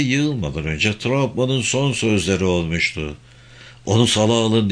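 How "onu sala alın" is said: ordinary delivery, nothing marked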